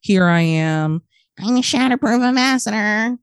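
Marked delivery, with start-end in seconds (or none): 1.4-3.2 higher, self-important mock introduction; mock proud tone. Retelling her Zoom breakout room introduction, mock self-important and higher. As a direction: send up her own earnest self-introduction, pitched up. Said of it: "You talked like that?".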